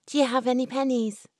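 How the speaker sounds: put-on a fast, bright girl's eager ask; eager young woman. Florrie eagerly asks her sweetheart for pennies, fast. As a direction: rush it like an excited girl.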